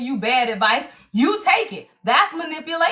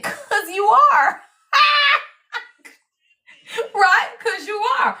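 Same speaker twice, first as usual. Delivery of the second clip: slow retort through laughter, raised up high and louder; laughing comeback; 7 semitones higher, 3 dB louder, slower than usual. Retold or imitated. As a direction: laugh it out like a punchline. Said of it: "laughing retort repeated".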